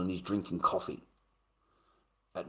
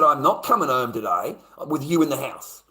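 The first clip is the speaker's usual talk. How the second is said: firm, louder retold refusal; angry; 3 semitones higher, 6 dB louder than usual. Man refusing to share his house, angry. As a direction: lay down the law angrily. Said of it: "I was so fucking angry".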